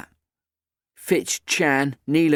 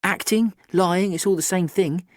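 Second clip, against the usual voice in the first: put-on higher, bright cheeky girl; 7 semitones higher than usual. A narrator's reading. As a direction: play the confident teenage girl. Kat voiced.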